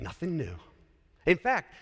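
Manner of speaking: usual speaking voice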